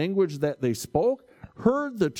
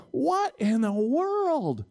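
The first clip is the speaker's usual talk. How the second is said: slow, higher, baffled mock wonder; drawn-out puzzled awe, a touch acted; 6 semitones higher, slower than usual. Preacher voicing the stunned crowd, slow mock awe. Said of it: "They're sitting there going".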